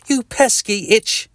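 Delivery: high, fussy telling-off; mock annoyed scold. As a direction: scold the little itch in a squeaky voice. Reader scolding the itch.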